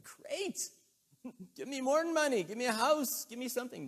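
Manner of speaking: high, loud mock-greedy begging; laugh, then plead excitedly at high pitch; eager excitement. Acts out eager beggar's response.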